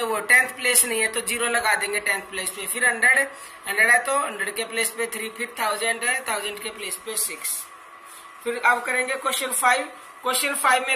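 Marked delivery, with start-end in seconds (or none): none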